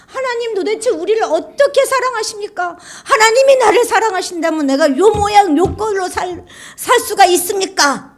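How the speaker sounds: a complaining people crying out to God; whining complaint. Acted. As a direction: plead like a wronged child. Israelites complain of God's love.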